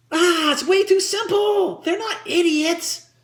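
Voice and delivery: high, slow, exasperated objection; exaggerated protest at a silly idea; dismissive ad boss. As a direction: push the pitch up and drag out the complaint. Acted ad boss rejects the pitch.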